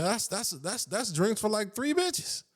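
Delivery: high-pitched incredulous squeal; can't believe the price; outraged disbelief. Real, not acted. Outraged disbelief over thirty-five dollar beers.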